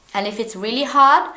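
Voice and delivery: ordinary delivery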